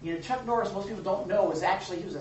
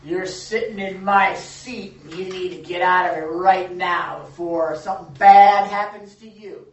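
threatening local yokel; 14 dB louder than usual. Retold or imitated. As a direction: growl it like a bully picking a fight. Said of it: "came up to him and, you know, said".

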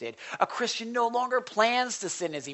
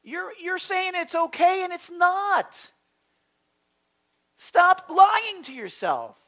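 much higher, louder, pleading rebuke; God's stern voice; 11 semitones higher, 7 dB louder than usual. Acted. Preacher voicing God confronting the congregation, high and loud. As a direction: plead with them to stop lying. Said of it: "But God is saying".

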